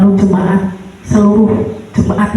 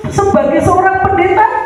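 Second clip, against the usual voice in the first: normal talking voice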